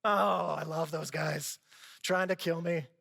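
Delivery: drip irony over the fondness; sarcastic mock affection. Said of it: "How could you possibly phileo love your enemies?".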